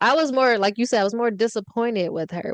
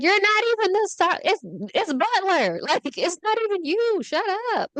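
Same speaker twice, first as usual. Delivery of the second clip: mock sassy, much higher pitch; snippy comeback pitched way up; retold argument; 11 semitones higher than usual. Retold arguing with Butler.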